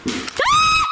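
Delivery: high sharp shriek of fright; startled scream. Real, not acted. Alone at night, a loud noise makes him scream. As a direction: yelp in pure shock.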